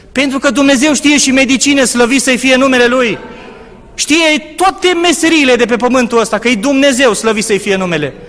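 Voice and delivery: loud, high proclamation of faith; fervent preaching. Acted. Preacher declaring God knows every trade, loud and high. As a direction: lift the voice and proclaim it to the congregation.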